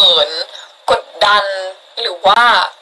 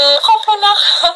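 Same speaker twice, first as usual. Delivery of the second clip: ขอบคุณนะคะ much higher in a cutesy voice; high cute thanks; 11 semitones higher than usual. Real, not acted. Streamer thanking viewers again, high and cutesy.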